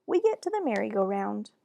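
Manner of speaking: ordinary delivery, nothing marked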